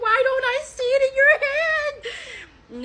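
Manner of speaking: high mock-tearful question; incredulous wail. Woman doubting his explanation, high incredulous wail. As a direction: wail the question in acted disbelief. Acts out her disbelieving retort.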